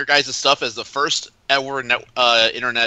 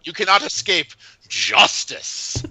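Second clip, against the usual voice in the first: villain threat; 6 semitones higher, slower than usual. Pretend. Guest as the villain threatens Steve in fun. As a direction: menace him like a comic-book villain.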